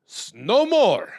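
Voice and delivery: high, slow Latvian heckler. Retold or imitated. Latvian man cuts the comic off: slow high heckler voice.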